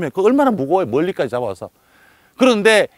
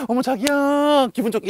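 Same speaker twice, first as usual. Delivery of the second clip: sweet wife; 9 semitones higher than usual. Retold or imitated. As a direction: coo at your husband. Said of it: "이래야 되잖아요".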